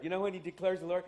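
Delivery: ordinary delivery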